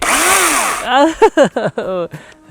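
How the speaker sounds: high burst of laughter; loud laugh. Real, not acted. After the butch-man boast, loud laughter. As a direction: laugh at yourself.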